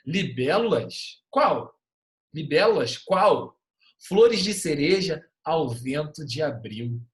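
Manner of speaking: slow, loud recital of a short poem; poem reading. Acted. Teacher reading a haikai to students, slow recital. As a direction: read it out like a poem to the class.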